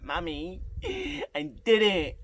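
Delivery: high, drawn-out tearful squeal; imitated crying. Calling mum after the audition, imitated happy crying. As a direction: wail it slowly like a sobbing child.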